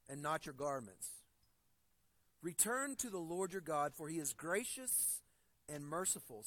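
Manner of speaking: measured, unhurried Bible reading; scripture reading. Acted. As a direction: read the verses out with solemn weight. Reads Joel passage aloud.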